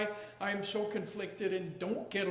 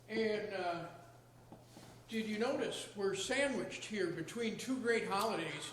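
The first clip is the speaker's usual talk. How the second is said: usual speaking voice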